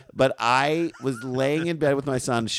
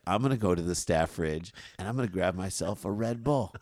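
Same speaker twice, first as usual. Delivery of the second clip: quiet deadpan line landing on BULL; softer, lower delivery with BULL as the operative word; stress on BULL; 4 semitones lower, 8 dB quieter than usual. Retold or imitated. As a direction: save the emphasis for BULL in Red Bull. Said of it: "the emphasis on bull".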